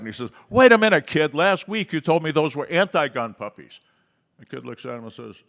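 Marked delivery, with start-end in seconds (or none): 0.5-3.8 much higher, much louder shocked protest; horrified outburst. Acted. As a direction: yelp it out, caught off guard. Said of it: "And he's horrified".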